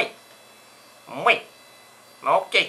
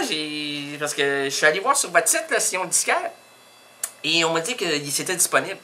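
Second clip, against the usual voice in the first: usual speaking voice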